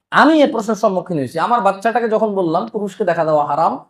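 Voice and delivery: usual speaking voice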